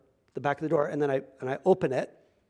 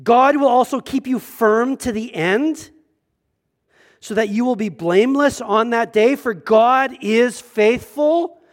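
raised, louder preaching voice, building to a peak; fervent sermon delivery climbing to a high ringing climax; 6 semitones higher, 8 dB louder than usual. Acted. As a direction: preach it out to the whole room. Preacher at full force, scripture.

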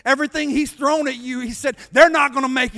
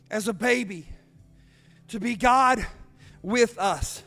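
lower, softened sermon voice; solemn preaching; 4 semitones lower, 5 dB quieter than usual. Real, not acted. Preacher inviting the congregation to stand, solemn and softened. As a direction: ease down into reverent preaching.

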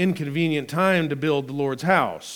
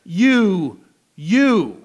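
"You, you" each hit hard and louder; emphatic you; 4 semitones higher, 7 dB louder than usual. Shown as a demonstration. Preacher showing the Hebrew repeat, both words hit. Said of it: "The original Hebrew says".

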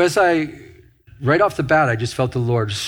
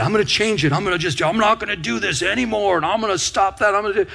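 higher-pitched, insistent run of vows; mock self-reliant resolve; 6 semitones higher, faster than usual. Preacher voicing a believer's own mindset, mock determination. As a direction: imitate someone stubbornly set on fixing it alone. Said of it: "you've got this mindset".

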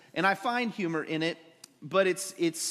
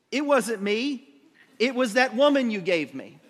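mock-defensive denial, raised and loud; hammed-up blame-shifting; Adam; 4 semitones higher, 5 dB louder than usual. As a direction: pass the blame quickly in a higher, louder voice. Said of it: "holy man, says".

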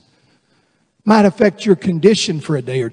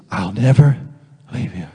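low, gentle voice falling away; God's reassurance; 10 semitones lower, 4 dB quieter than usual. Acted. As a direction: answer low and steady, with a pause before the last words. God's reply to each confession.

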